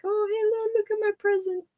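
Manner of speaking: high, sweet toy-bear voice; cute bear voice. Pretend. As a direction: make the little bear show off his present. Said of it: "He'll be like this".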